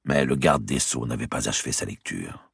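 ordinary delivery

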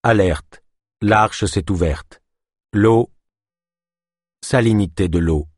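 steady machine alert voice; computer voice. Acted. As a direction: announce it like a system alarm. Computer announces flooding.

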